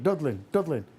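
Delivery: "Dublin" twice with a d for the b, "Dudlin"; puppet's mispronunciation. Shown as a demonstration. Puppet correcting his partner, "Dublin" said as "Dudlin". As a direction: insist on "Dublin" as "Dud-lin". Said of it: "say it".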